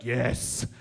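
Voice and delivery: slow, low sinister hiss; Emperor Palpatine. Retold or imitated. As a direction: tempt them slowly like a dark lord. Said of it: "Emperor Palpatine going like".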